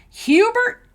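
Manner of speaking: high, loud, drawn-out country holler; grandma's yell. Retold or imitated. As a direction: imitate grandma hollering into the next room. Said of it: "my grandma yells to my dad".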